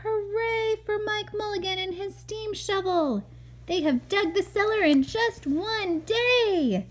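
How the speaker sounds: high cheering crowd. A narrator's reading. Narrator voicing people cheering the steam shovel, high. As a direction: keep the crowd cheer going. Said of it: "shouted all the people".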